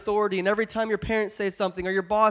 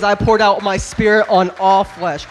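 louder and slow, every word hammered; preached scripture; 13 dB louder than usual. Shown as a demonstration. Pastor thundering scripture to the congregation, loud and slow. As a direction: proclaim it to the whole room. Scripture repeated at peak of sermon.

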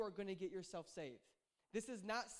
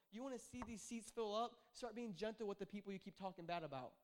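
normal talking voice

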